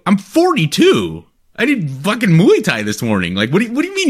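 worked-up mock outrage, rising at the end; indignant. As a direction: re-enact an indignant comeback to a complaint. Retelling his indignant reply to assistant.

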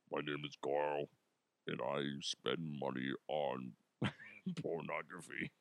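very low, quiet disguised voice. Pretend. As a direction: drop it way down like an anonymous caller. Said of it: "I'll disguise your voice".

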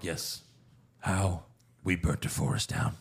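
low, quiet voice, lightly acted; lightly voiced butler, hushed and low; Alfred. Alfred answering Bruce about the bandit, lightly acted low and quiet. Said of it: "he's like".

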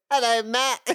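high, nerdy squeaky hello; Urkel voice. Retold or imitated. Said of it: "that weird kind of Urkel voice".

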